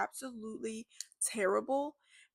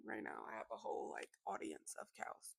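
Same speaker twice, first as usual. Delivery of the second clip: whispering; 14 dB quieter than usual. Real, not acted. Joking aside about staring cows.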